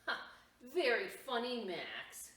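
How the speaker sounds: put-on older brother scoffing at Max; mocking brother. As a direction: sneer at the silly idea.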